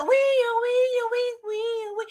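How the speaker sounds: high wee wee siren; siren wail. Pretend. Imitating the police siren before the stop, high wail. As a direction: wail it like a cop car.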